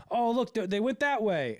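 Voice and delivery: loud, high mock alarm; imitated steward calling out. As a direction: call it out like a pursuer. Acts out stewards in pursuit.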